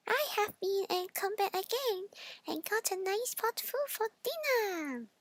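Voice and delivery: high, soft piggy voice; pleased with himself, high; smug little pig. Acted. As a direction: pig teasing the wolf. The pig outwits the wolf.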